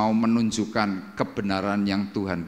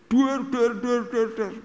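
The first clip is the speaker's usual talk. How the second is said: high rapid-fire banging noises; mimicked pounding on one high note; imitated door banging; 13 semitones higher, slower than usual. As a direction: voice fists hammering a door, high-pitched.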